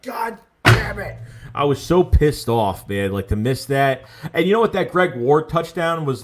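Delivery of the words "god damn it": slow, high, mock-frustrated groan; retold frustration. Retelling his reaction to an overthrown pass, mock frustrated curse. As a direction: replay the curse like a fan watching the miss. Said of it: "I was like".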